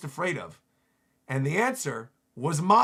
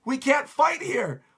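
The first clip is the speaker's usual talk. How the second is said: high, loud acted panic; panicked screaming; 10 semitones higher, 6 dB louder than usual. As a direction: yell in alarm as the fight is lost. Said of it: "they're all screaming once again".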